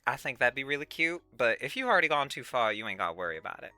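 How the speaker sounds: ordinary talk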